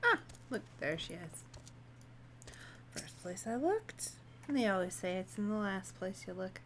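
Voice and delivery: usual speaking voice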